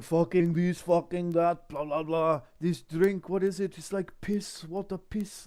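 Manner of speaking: hammed-up Russian complaining about the beer; Russian accent. Mocking the Russian guys in the pub, in a loud Russian accent. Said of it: "these Russians are going".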